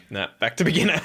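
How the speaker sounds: amused self-mocking echo, laughing; laughing repeat. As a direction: repeat it cracking up at yourself. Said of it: "and you're like".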